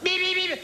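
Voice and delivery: high nasal beep like a car horn; imitated car horn beep; mock horn beep. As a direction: toot it like a horn. Horn beeping.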